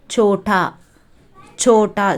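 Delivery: சோட்டா as "chho-taa", long o; Hindi pronunciation. Shown as a demonstration. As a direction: model சோட்டா for learners, chho-taa, pausing between repeats. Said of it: "சோட்டா அப்படின்னு pronounce பண்ணணும்". (சோட்டா, இந்தி உச்சரிப்பில் "ச்சோ-டா")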